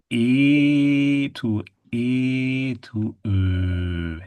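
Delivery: slow, drawn-out E moving to a rounded U; vowel glide drill. Shown as a demonstration. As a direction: stretch each E, then round into U. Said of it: "the lips come together to a point".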